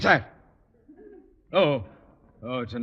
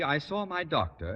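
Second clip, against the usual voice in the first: ordinary talk, nothing marked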